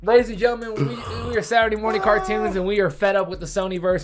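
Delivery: rally the crowd like a fed-up host; hammed-up angry announcer; mock host rant. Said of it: "show host rant".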